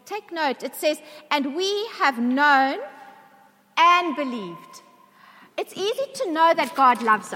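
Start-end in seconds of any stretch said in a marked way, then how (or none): 1.3-4.8 slow, loud, weighty stress on "known" and "believed"; emphatic re-reading. Shown as a demonstration. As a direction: stretch out "known", pause, then land "believed" hard. Re-reads verse after "take note.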